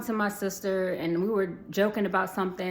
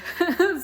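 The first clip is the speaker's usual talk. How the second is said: short high laugh; 11 semitones higher, 5 dB louder than usual. Real, not acted. Laughs mid-sentence about big school.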